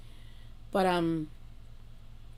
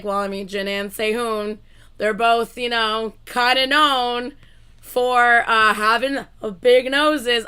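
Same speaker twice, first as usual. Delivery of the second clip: mock innocent viewer; 3 semitones higher, 11 dB louder, slower than usual. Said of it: "y'all were like".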